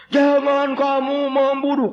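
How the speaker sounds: high, slow, loud recital. Acted. Missionary has villagers recite commandments loudly. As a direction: shout it slowly like a drill.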